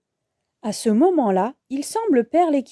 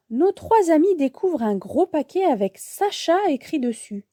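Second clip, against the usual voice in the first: usual speaking voice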